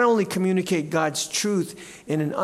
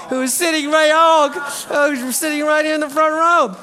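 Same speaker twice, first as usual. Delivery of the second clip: excited; 10 semitones higher, 7 dB louder than usual. Real, not acted. Calling out a man in front row.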